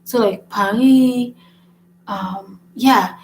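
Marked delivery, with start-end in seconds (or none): 0.5-1.3 "Paris" with a silent final s; "Paris" the French way, drawn out on "ee"; French pronunciation. Shown as a demonstration. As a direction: slowly say "Paris" as "pa-REE". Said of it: "When the last letter is silent".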